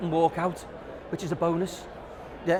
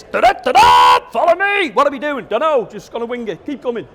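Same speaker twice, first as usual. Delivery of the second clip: louder and higher at first, slowed fanfare; bossy charge; 4 semitones higher, 7 dB louder than usual. Retold or imitated. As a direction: lead the charge like a pushy boss. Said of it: "so the Ds are like this".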